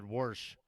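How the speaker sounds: "Warsh", wash with an added r; Pittsburgh pronunciation. Retold or imitated. Teasing a friend's accent, "Warsh" with intrusive r. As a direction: imitate a friend's "Warsh", r before the sh.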